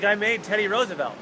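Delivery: proud reveal. Retold or imitated. Marcus proudly unveils the third gargoyle.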